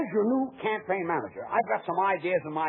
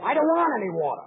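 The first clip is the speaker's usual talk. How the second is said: irritated protest; 8 semitones higher, 6 dB louder, faster than usual. Acted. George irritated by the nonsense.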